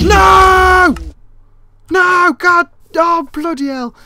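high, loud frantic yells; panicked shouting. Real, not acted. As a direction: yell in terror as they hit you. Attacked by zombie pigmen.

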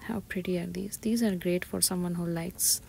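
normal talking voice

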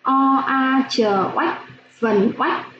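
slow teacher spelling, each sound apart; spelling out syllables. Shown as a demonstration. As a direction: sound out O, a, chờ, then blend into oách. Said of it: "Các em nghe cô đánh vần".

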